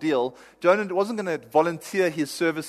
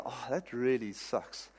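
much softer, slow, syrupy comfort; mock sympathy; 3 semitones lower, 12 dB quieter, slower than usual. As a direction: coo it like a pitying friend. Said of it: "I need somebody that'll go".

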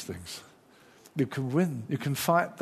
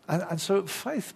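ordinary delivery

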